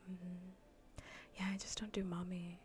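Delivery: ordinary delivery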